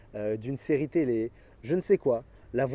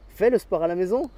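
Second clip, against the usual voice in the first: louder and higher, pushing the point home; insistent coach; 5 semitones higher, 5 dB louder than usual. Acted. Coach pressing a lazy friend to train, insistent louder order. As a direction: repeat the order with more force.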